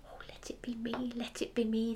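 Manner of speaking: a whispered hopeful plea; hope it silently, whispering; under their breath. Acted. The family hopes for the wish, whispering under breath. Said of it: "they all said under their breath".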